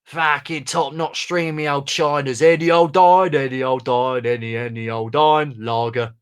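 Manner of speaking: put-on Cockney voice, drawn-out any old dine; sing-song Cockney rag-and-bone call; Cockney street cry. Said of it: "I'll read it as Wonky Steve".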